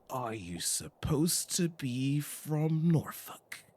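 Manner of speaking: slow, high, drawn-out query; puzzled questioning. Acted. Player guessing at the written accent, slow exaggerated puzzlement. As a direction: stretch out the question in mock puzzlement. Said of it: "I'm reading the text and I'm like".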